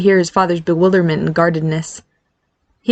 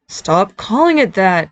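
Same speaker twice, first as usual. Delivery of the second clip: moaning; slower than usual. Acted. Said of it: "Barry moaned in his mind".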